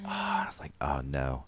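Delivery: panicked whisper. Retold or imitated. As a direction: breathe it out in panic. Panicked aside at security.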